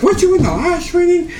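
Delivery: high nagging mock voice of a coworker; mimicking coworker.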